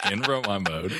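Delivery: chuckling through the phrase; laughing. Real, not acted. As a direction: laugh while saying it.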